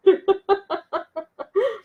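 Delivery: high, light chuckle; laugh. Real, not acted. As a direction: laugh in a quick run of high bursts. Amused after greeting the grandmother. (risada aguda e divertida)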